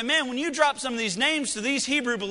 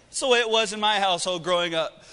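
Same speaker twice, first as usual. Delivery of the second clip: ordinary talk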